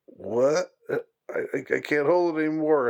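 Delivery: mock panic, can't hold it; desperate student. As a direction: blurt it out like you're about to burst.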